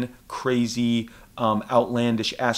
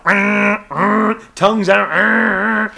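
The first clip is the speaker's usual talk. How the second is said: loud silly cartoon grunts; hammed-up dopey sounds; goofy tongue-out noise; 8 semitones higher, 8 dB louder, much slower than usual. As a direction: pull a cartoon face and grunt. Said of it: "everyone's like".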